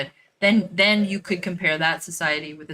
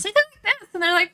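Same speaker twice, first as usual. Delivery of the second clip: much higher, sing-song Valley Girl impression; 6 dB louder, faster than usual. Shown as a demonstration. Mocking how Valley Girls sound to listeners, in a much higher ditzy voice. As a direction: squeak it up like a mocked Valley Girl. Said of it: "like, Valley Girls".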